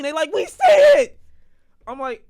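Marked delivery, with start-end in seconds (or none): 0.3-1.2 piercing, very loud mock shriek; exasperated sisters' scream. Imitating sisters answering his urging, screaming. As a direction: scream it back, fed up. Sisters snap back in quarrel.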